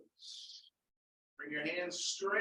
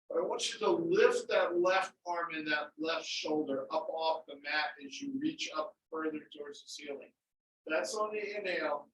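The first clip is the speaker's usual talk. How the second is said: usual speaking voice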